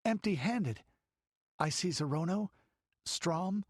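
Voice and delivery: put-on small girl gazing at sunset; young girl. Girl at the window describes sunset, girlish voice. Girl naming the fleeing men.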